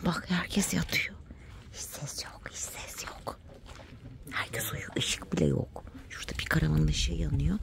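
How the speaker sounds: soft whisper so as not to wake anyone. Real, not acted. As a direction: hush it so the campsite stays asleep. Campsite asleep, keeping quiet.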